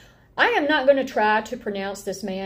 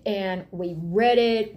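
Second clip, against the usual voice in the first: ordinary delivery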